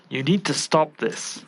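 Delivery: ordinary talk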